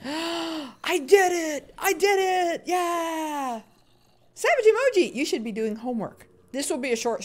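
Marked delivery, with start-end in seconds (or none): 0.1-3.6 high, drawn-out cheer of triumph; real excited whooping; triumphant cheer. Real, not acted. Celebrating catching the zombie. 4.3-5.1 high, loud call to a viewer; bright-pitched greeting shout. Real, not acted. Calling out a chatter's name.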